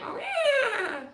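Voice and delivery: high squealing horse neigh; horse whinny. Pretend. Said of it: "That was a horse. That was pretty good".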